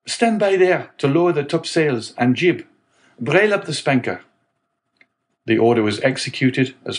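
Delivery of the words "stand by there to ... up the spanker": high called-out commands; shouting orders. A narrator's reading. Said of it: "the young man shouted".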